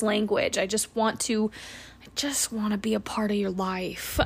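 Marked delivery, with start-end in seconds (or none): 2.0-4.2 whispering. Real, not acted. Intimate aside to listeners.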